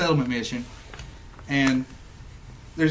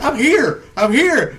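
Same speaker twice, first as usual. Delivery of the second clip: louder, higher re-enacted shout; retold calling out; 8 semitones higher, 6 dB louder, much slower than usual. Player hollers to lagging companions while sprinting, mock calling out. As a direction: call out that you've arrived, raised voice.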